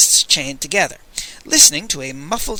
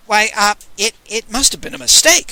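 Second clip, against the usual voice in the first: flustered; 6 semitones higher, 4 dB louder than usual. Acted.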